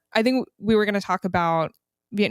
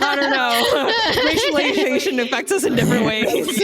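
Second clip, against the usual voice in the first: loud, high laughing talk; 9 semitones higher, 7 dB louder than usual. Real, not acted. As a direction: laugh hard through the teasing. Teasing sibling, laughing.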